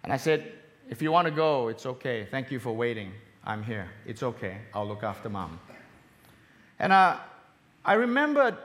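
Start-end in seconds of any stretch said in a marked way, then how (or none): 0.9-5.6 much softer, gentle farewell words; tender and hushed. Retold or imitated. Son at his dying father's bedside, soft and tender. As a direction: soothe him, let him go softly.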